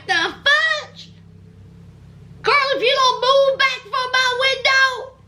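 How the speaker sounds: high-pitched, put-on indignation; indignant mock outrage. Homeboy to the girlfriend crowding his car window, mock outrage.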